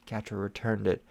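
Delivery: plain reading voice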